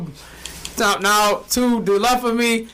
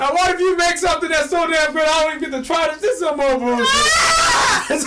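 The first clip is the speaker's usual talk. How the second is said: higher, whiny indignant shout; hammed-up complaining yell; mock outrage; 8 semitones higher than usual. Mock outrage about missing food.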